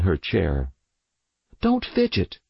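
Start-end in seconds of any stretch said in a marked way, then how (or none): 1.6-2.4 high, sharp snap; snapped. Acted. Mother snaps at her fidgeting daughter, high and sharp. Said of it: "Mother snapped".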